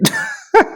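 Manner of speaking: short high-pitched laugh; quick derisive laugh; scoffing laugh. Real, not acted. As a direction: burst out in a brief high laugh. Laughing at the played clip.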